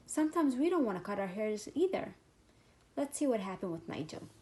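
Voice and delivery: plain reading voice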